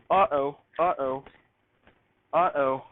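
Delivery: ordinary delivery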